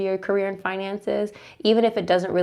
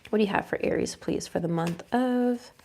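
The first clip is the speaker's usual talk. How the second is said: quiet ritual asking; 7 dB quieter than usual. Real, not acted. Asks the deck before drawing.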